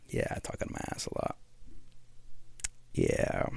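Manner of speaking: quick breathy whisper to himself; whispered aside. Real, not acted. Admitting to listeners he talks nonsense, fast whispered aside. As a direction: drop to a fast half-whisper. Self-mocking aside after laughing.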